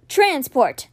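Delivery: ordinary delivery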